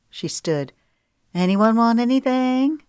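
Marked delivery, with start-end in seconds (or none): 1.3-2.8 higher, louder, bright offer to the table; higher breezy woman. Acted. Woman offering drinks to the group, higher and louder. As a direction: sing out the offer. Paulette voiced.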